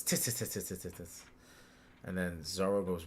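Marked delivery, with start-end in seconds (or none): 0.0-1.2 Luffy. Retold or imitated. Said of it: "Luffy goes".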